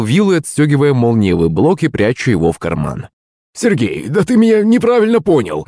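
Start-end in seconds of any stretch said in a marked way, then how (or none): 3.5-5.6 high, wailing plea; lamenting. A narrator's reading. Servant pleads with his master after the mishap, lamenting. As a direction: whine it high, pleading to be believed. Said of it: "запричитал Захарыч".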